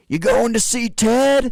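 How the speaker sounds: high, loud drawn-out holler; yelling guy. Retold or imitated. Factory worker calling to his buddies, excited holler. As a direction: holler it across the factory floor. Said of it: "hear one of the guys go".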